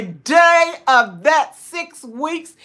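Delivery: "day of that six weeks" slow, high and loud, word by word; testifying emphasis. Real, not acted. Testifying about new subscribers every day, slow loud emphasis.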